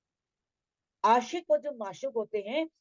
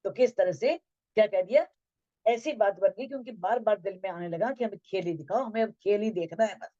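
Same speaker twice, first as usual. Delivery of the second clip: ordinary talk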